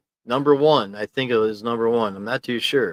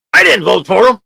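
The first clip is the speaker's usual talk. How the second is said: higher, louder acted quote; acted-out chat line; 9 semitones higher, 9 dB louder than usual. Shown as a demonstration. Streamer acting out a viewer's comment again, loud. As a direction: act out the viewer's line with punch. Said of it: "Trying to act it out for you".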